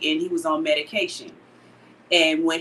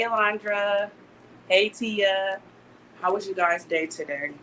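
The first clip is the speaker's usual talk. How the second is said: ordinary delivery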